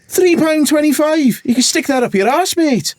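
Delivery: high, slow outraged attempt at a Scottish accent; a bad Scottish accent, scandalised at the price; mock Scottish fury with a Scouse slip; angry Scotsman. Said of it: "I'm terrible".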